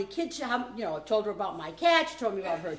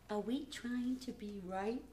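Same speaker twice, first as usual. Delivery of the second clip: gentle question; 10 dB quieter, slower than usual. Retold or imitated.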